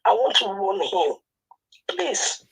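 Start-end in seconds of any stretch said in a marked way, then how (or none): 1.9-2.4 pleading. Real, not acted. Distressed caller begging.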